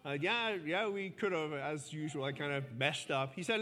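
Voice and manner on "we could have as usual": ordinary talk, nothing marked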